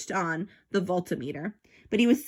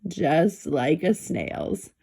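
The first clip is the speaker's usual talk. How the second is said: very slow, lower, stretched out; dragged out slowly; 7 semitones lower, much slower than usual. A narrator's reading. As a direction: drag each word as if slowed like a snail. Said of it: "very slow".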